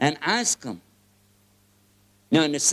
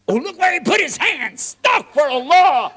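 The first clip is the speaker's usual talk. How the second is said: much higher and louder, horrified; scandalized shout; 12 semitones higher, 11 dB louder than usual. Acted. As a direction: cry out as if witnessing a sin. Scandalized regular sees convert praying.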